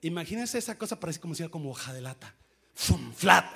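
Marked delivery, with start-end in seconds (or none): 2.7-3.4 squash sounds. Retold or imitated. Door crushes mother.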